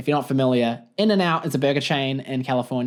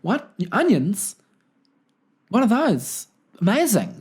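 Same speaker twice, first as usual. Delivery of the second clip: mock amazement; 7 semitones higher than usual. Playing naive New Zealander.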